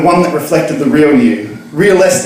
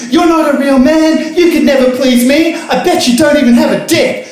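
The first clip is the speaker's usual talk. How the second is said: much higher mocking girl voices; jeering girls; 12 semitones higher than usual. Girls in the pub to reader, mock shrieking taunt. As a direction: taunt him.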